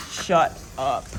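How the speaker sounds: low, slow, separated words; drawn-out disbelief. Real, not acted. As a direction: pause between the words, stunned. Repeats it slower in disbelief.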